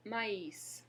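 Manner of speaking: Maïs with the final s said; Maïs, ma-is with a sounded s; French maïs. Shown as a demonstration. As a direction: confirm Maïs, two syllables. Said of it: "why I'm pronouncing the S".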